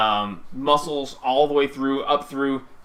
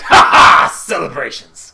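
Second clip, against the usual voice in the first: rowdy cheer; 12 dB louder than usual. Acted. Wrestler cheers.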